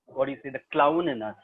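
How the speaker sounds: ordinary delivery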